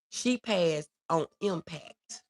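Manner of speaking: normal talking voice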